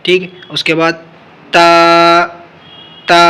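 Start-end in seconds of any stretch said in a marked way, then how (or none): 1.5-3.2 تا with tongue tip between the teeth; letter demo. Shown as a demonstration. Quran teacher demonstrating تا articulation. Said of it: "تا کو نکالنے کا طریقہ".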